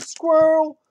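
high, loud yelp of "Squirrel!"; dog's squirrel alarm. Retold or imitated. Owner imitating his dog spotting a squirrel, high excited yelp. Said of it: "He's like".

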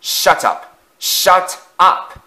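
loud angry bark, building. Acted. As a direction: bark them down harder. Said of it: "Afton barked back".

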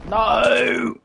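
high panicked shout; panicking gamer. Real, not acted. Caught again, panic shout.

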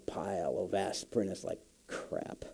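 breathy whispered curse under the breath; a dismayed whisper at the pile of aspirin; whispered aside. Real, not acted. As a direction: mutter it to yourself in a whisper. Private realization, aside to self.

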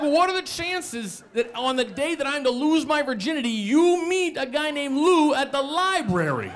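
very high, strained rant; acted high-pitched exasperation; incredulous outrage. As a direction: rant in disbelief, stretching the ending. Incredulous quarrel with roommate.

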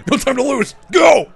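a soldier yelling at his squad to hurry; frantic shouting. Retold or imitated. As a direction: bark the orders like a panicked officer. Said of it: "He's like".

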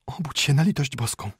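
high-pitched, urgent alarm; anxious, pleading wife; frightened wife. Acted. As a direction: report a burglar breathlessly to a sleeping husband.

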